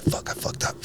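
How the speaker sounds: whispered under the breath, unvoiced; retold panicked whisper. Realizing the driver is strange, whispering in dread. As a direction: hiss it to yourself in dread. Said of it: "I was like".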